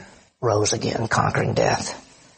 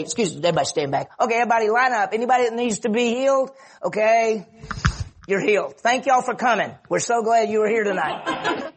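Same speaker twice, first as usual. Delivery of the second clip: raised, perky crowd-control patter; mock event host; 6 semitones higher than usual. Preacher imagines Jesus managing a healing line, mock hosting. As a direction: herd the crowd along like a cheery host.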